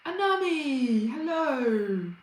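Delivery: ordinary talk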